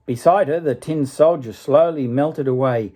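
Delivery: gentle storybook reading voice; story narration. A narrator's reading. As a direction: read it like a bedtime tale. Reads a fairy tale aloud.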